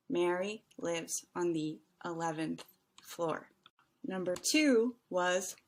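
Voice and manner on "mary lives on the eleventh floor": word-by-word, with a pause before "eleventh". Shown as a demonstration. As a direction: leave gaps between words, isolating "eleventh". Teacher slowly repeats the answer.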